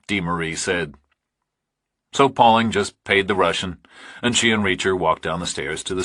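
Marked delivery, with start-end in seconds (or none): none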